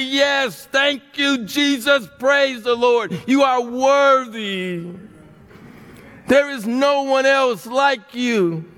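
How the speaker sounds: preaching praise. Acted. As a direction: lift it up to the Lord. Bursts into praise.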